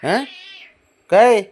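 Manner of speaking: loud startled call across the room; a loud questioning shout to the pets; sharp call. Real, not acted. Calling out to the pets.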